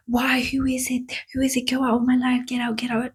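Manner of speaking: as if hunted by something unseen, ask who is it; mock frantic plea telling it to get out; panicked inner voice. Acting out her own panicked thoughts.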